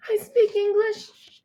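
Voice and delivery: put-on a girl desperate to be heard; inner shout. Girl silently wants to shout to the paramedic, high slow cry. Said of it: "I want to shout".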